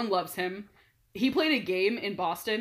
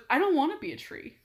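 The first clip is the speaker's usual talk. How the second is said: higher-pitched, upset protest; mock upset; 7 semitones higher than usual. Athlete objecting to being asked about trees, mock upset. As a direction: imitate someone genuinely put out. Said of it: "Like, genuinely upset".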